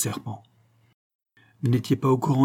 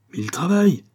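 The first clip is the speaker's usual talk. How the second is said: higher and a bit louder, taken aback; surprised; 7 semitones higher, 3 dB louder than usual. Acted. Farmer protesting to the detective, surprised. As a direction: sound caught off guard. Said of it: "s'étonna Ginoux".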